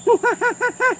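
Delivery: high squeaky monkey laugh; a gleeful little monkey chatter; monkey giggle. Acted. George agrees with a high monkey laugh.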